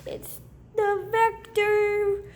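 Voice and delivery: slow, drawn-out show intro; intro announcer voice. Acted. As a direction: announce the channel name grandly. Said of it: "That's my own intro".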